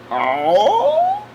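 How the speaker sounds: high, drawn-out "oh" and gasp; exaggerated gasp of discovery; hammed-up gasp. Hammed-up reaction to discovering store.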